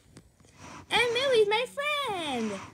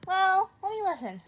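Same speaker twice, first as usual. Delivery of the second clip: ordinary talk, nothing marked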